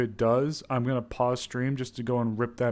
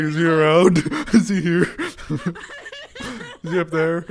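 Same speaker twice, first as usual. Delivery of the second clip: giggling through a nervous mock question; laughing imitation; 6 semitones higher, 10 dB louder, slower than usual. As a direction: laugh while acting jumpy. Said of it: "he's like".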